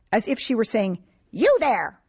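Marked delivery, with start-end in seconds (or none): 1.3-2.0 a commanding call, louder and higher; imperious command. A narrator's reading. Parrot seems to order Saga, imperious. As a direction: bark it like a queen giving orders. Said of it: "was imperious".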